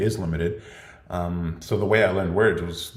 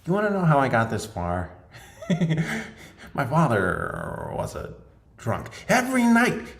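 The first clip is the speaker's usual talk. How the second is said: pitched-up movie villain with a laugh; 9 semitones higher than usual. Shown as a demonstration. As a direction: do a menacing Joker-style monologue. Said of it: "I can even do accents and voices like".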